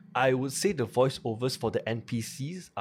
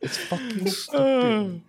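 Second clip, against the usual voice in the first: laughing through the words; slow, amused laugh; 12 semitones higher, 4 dB louder, slower than usual. Real, not acted. Laughing at a silly game design.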